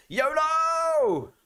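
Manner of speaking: very high drawn-out yolo cry; hammed-up yolo. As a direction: sing it out high and long. About to eat the beans.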